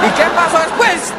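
high, loud curious voice; nosy questioner. Acted. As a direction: push for the gossip. Joke, questioner voice again.

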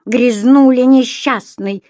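loud, slow, drawn-out scolding shout. A narrator's reading. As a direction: yell it at the lodger. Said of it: "закричала хозяйка".

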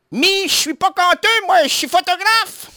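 high, hammed-up Ch'ti accent. Radio host imitating the singer in a Ch'ti accent. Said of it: "refait l'accent, euh, du ch'ti".

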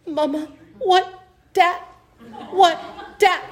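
put-on high, slow little-girl question; frightened toddler. Mother imitating her daughter cowering from the skeleton, high slow toddler voice. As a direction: ask it like a small child clinging to mom. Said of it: "And she just goes".

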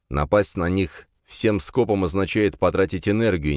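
ordinary delivery

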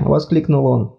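usual speaking voice

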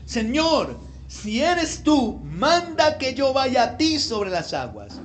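high, projected plea, drawn out in the middle; calling out. A narrator's reading. Peter answering Jesus from the boat, calling out.

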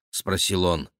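ordinary delivery

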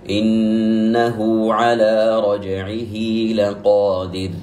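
إنه على رجعه لقادر with both ha sounds lengthened; slow recitation. Shown as a demonstration. Teacher summing up the examples, slow chanted recitation. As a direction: chant it slowly, stretching each hu and hi. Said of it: "يسمى الصلة الصغرى".

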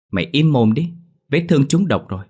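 scolding a whining friend, loud and sharp. Acted. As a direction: tell him to shut up, now.

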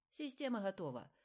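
clipped confirmation; combat callout. A narrator's reading. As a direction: answer like an operator on the net. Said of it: "эхом отозвался оператор".

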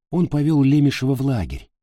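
ordinary delivery, nothing marked

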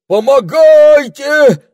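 piercing, drawn-out cries for help; верещание. A narrator's reading. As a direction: squeal in panic, very high and loud. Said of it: "заверещала мадам Гортензия". (истошно верещать)

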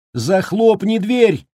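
put-on high sharp call to a child; urgent cry. A narrator's reading. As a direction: cry out in alarm. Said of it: "крикнула она".

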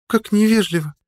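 put-on high girlish voice, gently reproachful; a sighed reproach, pitched far up; sighing girl. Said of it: "вздыхает девушка". (высоким девичьим голосом, со вздохом)

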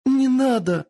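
high, loud tearful cry; terrified scream. Acted. Tortured girl begging her captors, terrified tearful cry. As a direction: beg through tears of pain. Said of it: "выкрикнула Кицуне, заливаясь слезами".

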